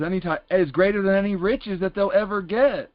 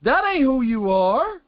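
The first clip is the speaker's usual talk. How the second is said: higher and slow, letting the words land; a parent let down by a good kid, slowly; disappointed parent; 5 semitones higher than usual. Retold or imitated. Parent to a kid caught cussing, slow and higher. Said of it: "and you're like".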